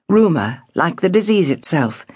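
usual speaking voice

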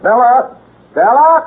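loud call up the stairs; high, loud call to someone upstairs. Acted. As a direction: shout her name up to the next floor. Calling upstairs for Bella.

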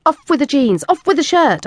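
directing. Acted. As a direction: order him to strip, impatient. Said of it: "I directed".

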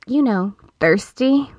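"thirsty" stretched slowly, every sound leaned on; drawn-out thirsty. Acted. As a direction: linger over "thirsty", slow and suggestive. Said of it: "I emphasized every letter of the word".

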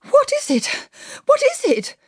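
very high, panicked gasped question; frightened gasp. A narrator's reading. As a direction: gasp it in alarm, pitched right up. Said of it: "Missus Lennox gasped".

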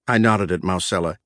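plain reading voice

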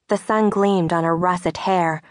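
ordinary delivery

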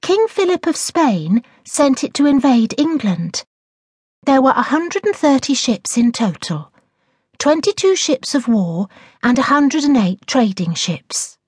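high-pitched know-it-all pupil; smug schoolgirl reciting her homework. A narrator's reading. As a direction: show off the facts to the teacher. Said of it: "Laura said in a smug voice".

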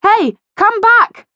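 high, loud, drawn-out call. A narrator's reading. Postman calls after his runaway letters, loud high call. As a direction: call after something getting away, slow and high. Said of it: "called a voice".

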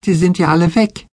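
put-on high child's exclamation; calling out. A narrator's reading. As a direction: call out in Betsy's high voice. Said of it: "rief Betsy".

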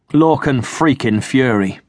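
slow, sneering contempt; Stukely sneering at the rival captain's name. A narrator's reading. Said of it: "Stukely sneered".

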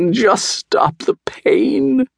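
very high, breathy, drawn-out whimper. A narrator's reading. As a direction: whimper pitifully like a fox in pain. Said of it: "whimpered the fox".

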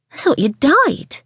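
high, slow, pointed remark; sarcastic complaint. Acted. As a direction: needle the late waitress.

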